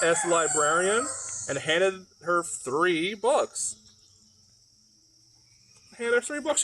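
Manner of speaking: usual speaking voice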